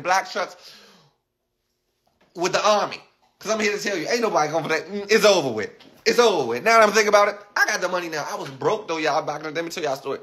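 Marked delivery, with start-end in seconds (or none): none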